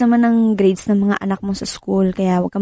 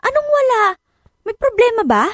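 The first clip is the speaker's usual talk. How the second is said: worried surprise; 14 semitones higher than usual. Acted. Worried wife presses husband.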